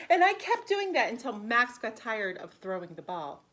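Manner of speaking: bright, lively read-aloud for preschoolers; animated picture-book narration; storybook reading. Acted. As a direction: read it like story time for little kids. Teacher reads picture book aloud.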